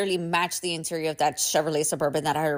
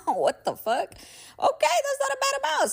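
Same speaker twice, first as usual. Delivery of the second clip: laughing what the fuck, then much higher okay; relieved surprise. Real, not acted. Finding out she owes only eleven thousand, high relieved surprise. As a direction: relief breaking into a high squeak.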